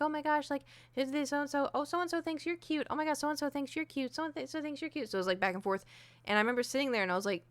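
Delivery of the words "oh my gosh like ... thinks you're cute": put-on high, excited teen squealing; squealy gossip. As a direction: gush like giddy girlfriends. Friends squealing over texts.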